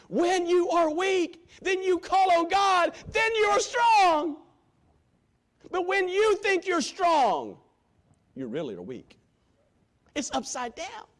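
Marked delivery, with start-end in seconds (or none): 0.1-7.6 slow, loud, high preaching shout; fiery preacher's cry; full-force preaching. Real, not acted. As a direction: thunder it out to the congregation.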